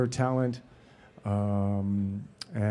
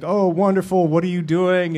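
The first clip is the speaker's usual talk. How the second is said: higher, eager imitation of a steward; gushing fan; 6 semitones higher, 4 dB louder than usual. Steward chatting to a film star on a plane, mock gushing. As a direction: mildly gush like a delighted fan.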